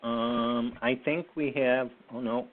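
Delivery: ordinary delivery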